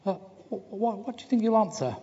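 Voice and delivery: very high, stammering piglet voice. Acted. Piglet asking Pooh eagerly, high and stammering. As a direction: stutter it out like a nervous little pig. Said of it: "Piglet asks Pooh".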